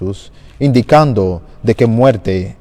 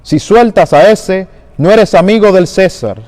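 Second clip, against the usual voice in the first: crowd shouting; 7 semitones higher than usual. Acted. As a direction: shout it up at Pilate as a warning. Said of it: "los judíos gritaban".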